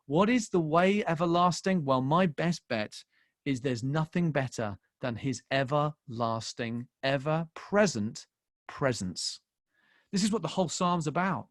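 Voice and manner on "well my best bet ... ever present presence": normal talking voice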